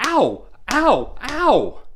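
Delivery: three high, loud ows; acted yelps of pain. Boy slapped by teacher's ruler, high acted yelps. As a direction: yelp sharply at each slap, acted.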